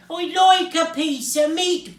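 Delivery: loud, high booming request. A narrator's reading. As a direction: boom it out across the shop.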